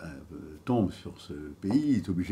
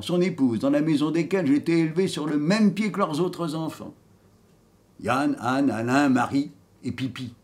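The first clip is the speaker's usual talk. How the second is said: proud, cheeky rogue, louder and higher than chat; dead vagabond telling his life; 4 semitones higher, 7 dB louder than usual. Acted. Dead man boasting of his life, theatrical and defiant. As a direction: declaim like a storyteller, rising on each boast. Acts the dead man's monologue.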